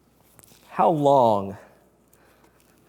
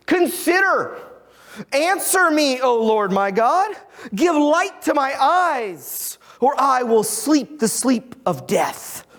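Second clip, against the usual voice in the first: raised, pleading psalm reading; scripture reading; 6 semitones higher than usual. Acted. Pastor reading the psalm to his congregation, raised and pleading. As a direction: read the lament out as if crying to heaven.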